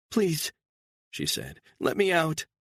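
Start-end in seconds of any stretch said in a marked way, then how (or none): 0.1-0.5 high pleading female voice; frightened woman. A narrator's reading. Captive woman pleading with her kidnapper, high and frightened. 1.8-2.5 high pleading female voice; frightened woman. A narrator's reading. Captive woman pleading with her kidnapper, high and frightened.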